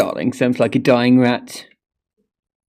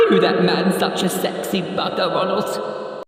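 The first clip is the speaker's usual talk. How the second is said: higher, camp theatrical tone; 10 semitones higher, 5 dB quieter than usual. Pretend. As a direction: camp it up in mock horror. Camp reaction to noise.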